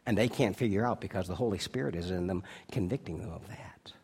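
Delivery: soft unvoiced whisper; whispered aside. Pastor confiding to the congregation in a whisper. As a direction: drop to a hush to confide it.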